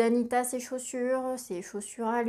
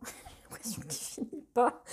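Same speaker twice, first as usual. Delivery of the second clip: whispered nervous laugh. Real, not acted. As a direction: laugh it off under your breath, embarrassed. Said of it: "Pardon, c'est un rire nerveux".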